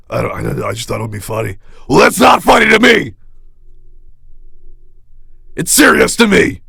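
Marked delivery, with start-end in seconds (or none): 1.9-6.6 hurt indignant shout. Acted. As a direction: snap back, wounded. Offended fan shouting back.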